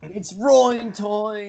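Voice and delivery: high, slow, drawn-out quote; character catchphrase. Retold or imitated. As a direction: stretch it out like a game hero. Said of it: "is probably one of the worst".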